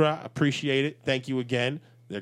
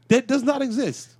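exasperated; 10 semitones higher, 5 dB louder than usual. Real, not acted. Heated argument over slang.

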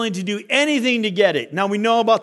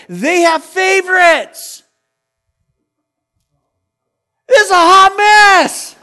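rising to a high, loud shout; exasperated outcry; 8 semitones higher, 7 dB louder, slower than usual. Real, not acted. Preacher exclaims about family mess.

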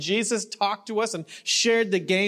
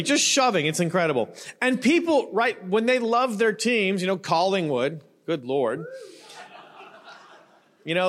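ordinary talk, nothing marked

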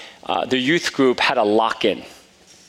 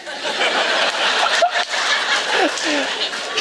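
a high amused laugh; high burst of laughter. Real, not acted. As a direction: laugh high and helpless at the punchline. Son's request to be quiet.

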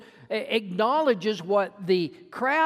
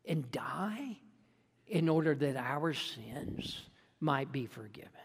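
softer, whispered words about death; solemn, whispered, softer; hushed whisper; 9 semitones lower, 9 dB quieter than usual. Acted. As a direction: let it fall to a breathless whisper. Solemn climax of confession.

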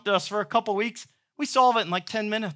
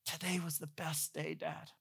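put-on much softer, drowsy child's murmur; sleepy whisper; 3 semitones lower, 19 dB quieter than usual. Kids falling asleep telling dad, sleepy soft murmur. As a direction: breathe it out as a child drifting off. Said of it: "They fall asleep whispering".

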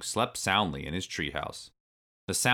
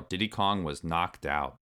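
plain reading voice

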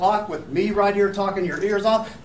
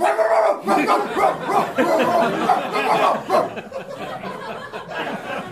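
laughing; 10 semitones higher than usual. Real, not acted. Laughing at own dog joke.

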